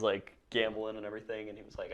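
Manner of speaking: ordinary talk, nothing marked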